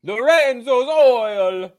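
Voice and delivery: slow, loud, hammed-up gravelly Italian accent; mock Nick Nolte Italian accent. Co-host mocking Nick Nolte's film role, hammed-up Italian accent. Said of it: "Oh, I'm Nick Nolte".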